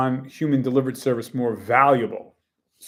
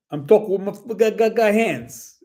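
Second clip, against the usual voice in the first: higher-pitched exaggerated stumbling over words; mock stammer; 8 semitones higher, 3 dB louder than usual. Shown as a demonstration. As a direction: show off human quirks with a playful stutter. Showing off human ticks, quirks.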